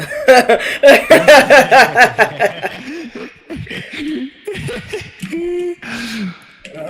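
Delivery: loud, high burst of laughter; laughing fit. Real, not acted. Speaker cracking up after being seen, helpless laughter. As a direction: laugh hard, then let it fade out.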